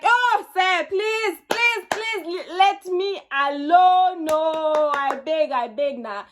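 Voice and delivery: high, loud begging, easing toward the end; hammed-up plea that gradually comes down in pitch; exasperated pleading. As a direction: beg dramatically to be left alone, dragging the words. Acting out her pleading refusal to suitor.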